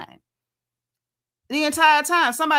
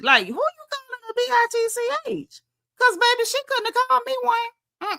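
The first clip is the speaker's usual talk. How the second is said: much higher, indignant squeal; shocked high outrage; 12 semitones higher than usual. Retold or imitated. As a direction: sound scandalised and shrill with disbelief. Table reacts to B word.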